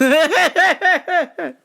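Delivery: loud, high laugh; high cackle. Real, not acted. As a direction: crack up at yourself. Laughing at own story.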